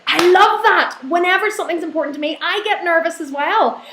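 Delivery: ordinary talk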